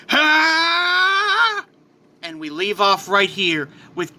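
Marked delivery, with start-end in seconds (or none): 0.1-1.7 long, high, rising battle cry; power-up scream. Acted. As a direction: unleash a sustained rising yell. Hero's power erupts.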